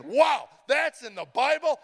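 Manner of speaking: slow, high, loud astonished cry; amazed. Retold or imitated. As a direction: gasp it out as if the Bible stunned you. Amazed reaction to scripture.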